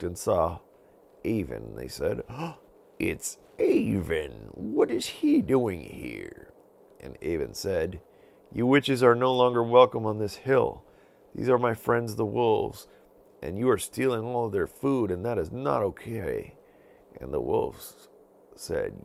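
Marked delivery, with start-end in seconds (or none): 2.3-6.5 slow, drawn-out witch voice; a witch eyeing her enemy; sneering witch. Acted. Witches spotting Avon, slow scornful witch voice. 8.5-16.6 louder, higher stern order; stern command. Acted. Avon ordering witches off the hill, loud stern voice.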